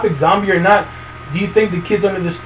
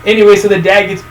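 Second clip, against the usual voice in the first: ordinary talk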